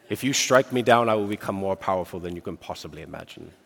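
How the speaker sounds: hammed-up English accent, playing Obi-Wan Kenobi in a duel; bad English accent. Preacher quoting Obi-Wan to the congregation in a terrible English accent. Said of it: "That's a terrible, terrible, uh, English accent".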